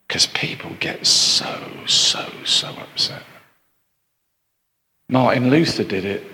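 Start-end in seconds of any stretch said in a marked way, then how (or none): 0.1-3.2 whispers the point to the congregation; hushed, voiceless aside; whispered aside. Acted. Preacher leans in to the church, whispering. Preacher drops voice on upset people.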